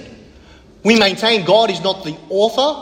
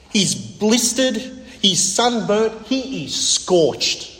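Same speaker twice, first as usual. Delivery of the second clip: slow emphatic list; 2 semitones higher, much slower than usual. Acted. Preacher dwelling on Jonah's suffering.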